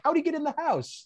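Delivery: very high, squeaky question; high-pitched comic puzzlement; mock bafflement. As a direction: ask it in cartoonish disbelief. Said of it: "it's like Bugs Bunny".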